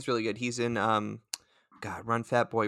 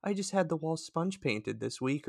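mock quote; 7 semitones higher than usual. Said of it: "he just says it like".

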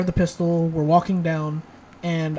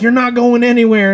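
menacing loud threat; 6 semitones higher, 10 dB louder than usual. Retold or imitated. Giant woman threatens them.

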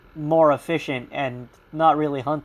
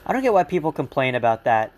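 ordinary talk

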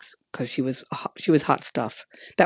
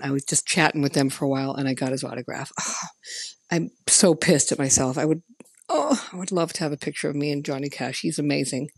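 usual speaking voice

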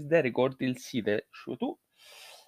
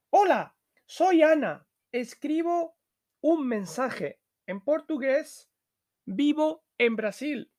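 much higher voice for the character Ana; Ana's voice; 13 semitones higher than usual. Acted. Teacher reading Ana's lines to the class, high pitched.

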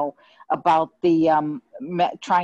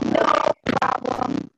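underwater; slower than usual. Real, not acted. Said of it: "Sounds like you're underwater".

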